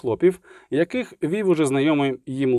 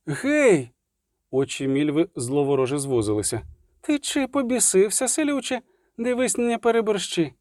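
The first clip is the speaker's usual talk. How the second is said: high; indignant warning; 6 semitones higher than usual. A narrator's reading. Milva threatens the demanding peasant.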